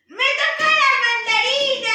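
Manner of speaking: loud, excited announcement; excited shout. Real, not acted. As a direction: shout it out with joy. Draws mandarin, excited.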